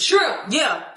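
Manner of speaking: usual speaking voice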